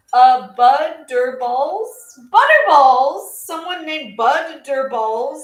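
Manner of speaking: slow, loud, swooping delivery of a silly name; drawn-out name reading. Real, not acted. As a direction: savor each syllable of the silly name, loudly. Sounding out a silly username.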